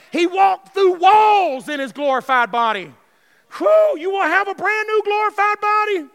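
loud, high, exultant preaching shout; full-force preaching. Real, not acted. Preacher promising the church a glorified body, exultant shouted preaching. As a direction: shout it jubilantly, with a whoop.

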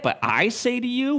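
high, rising questioning quote; incredulous echo. Acted. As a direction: throw the words back in disbelief. Shocked listener echoing Jesus's words.

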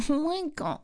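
high, soft mock whine; small, high-pitched whingeing; whiny. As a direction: imitate a friend's whiny complaint. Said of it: "It's like when you get whiny".

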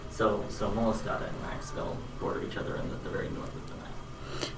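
distant, off-mic voice; far from mic. Real, not acted. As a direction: speak from across the room. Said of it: "I'm gonna step away from the mic".

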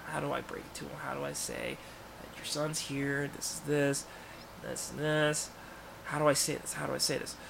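soft private worrying aloud, lightly acted; thinking aloud to himself. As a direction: mull it over softly, half to yourself. Acts out Peter rehearsing to himself.

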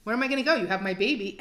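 high, loud retort; indignant protest. Retold or imitated. Mother told to leave her baby, indignant protest. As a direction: throw it back in disbelief.

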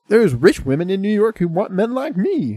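high, loud mock Southern accent; bad Southern accent. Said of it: "this really bad Southern accent".

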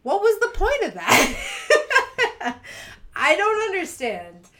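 high, slow, laughing disbelief; bewildered through laughter. Retold or imitated. Reacting to a rude candy bracelet, laughing disbelief. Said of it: "I'm like".

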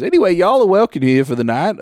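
high, loud host greeting; welcoming innkeeper. Acted. Innkeeper welcoming the travelers for the night, hearty. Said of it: "He goes".

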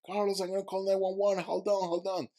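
high, urgent call from the hill; friend shouting. Retold or imitated. Friend on the hill shouting to the drowning swimmer, urgent. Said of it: "So he started jumping".

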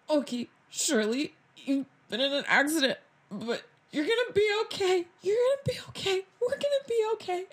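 high, shaky attempt at reassurance; forced calm. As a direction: try to sound calm while panicking. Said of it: "but calm down".

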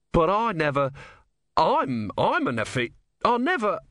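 high, stammering, bewildered; flustered inventor. Acted. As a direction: splutter in nervous protest.

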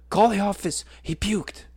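high, loud urgent blurt; frantic urgency. Retold or imitated. Out-of-breath colleague reporting puke, high frantic blurt. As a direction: spit out the news in a panic. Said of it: "completely out of breath".

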